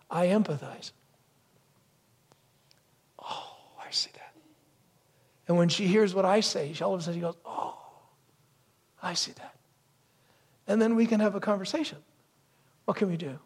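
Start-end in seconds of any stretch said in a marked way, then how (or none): 3.2-4.3 soft whisper of dawning understanding; hushed empathy. Acted. Husband suddenly empathizing with wife, whispered realization. 7.4-9.5 soft realization. Retold or imitated. Acts out wife's dawning understanding.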